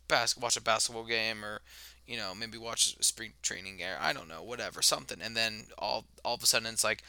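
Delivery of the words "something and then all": ordinary delivery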